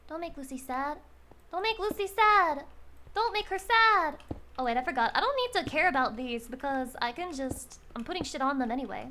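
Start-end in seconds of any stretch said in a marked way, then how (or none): 1.5-4.2 high, insistent whiny plea; mock pleading. Streamer telling chat not to make Lucy sad, pleading. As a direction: beg chat with hammed-up urgency.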